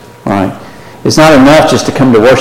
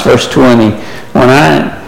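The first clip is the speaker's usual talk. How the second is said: ordinary talk, nothing marked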